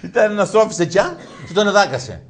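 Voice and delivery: high, laughing while telling it; giggling through the punch. Real, not acted. Teller laughs at own joke. (γελώντας ψηλά)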